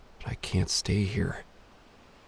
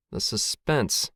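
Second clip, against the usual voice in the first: slow, stretched "The suspense."; drawn-out suspense; slower than usual. A narrator's reading. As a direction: draw out "suspense" slowly. Dramatic repeat, killed by suspense.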